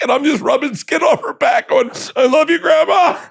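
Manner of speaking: high, laughing-through-words telling; laughing while telling. Real, not acted. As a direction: barely get it out for laughing. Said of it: "laughing through story".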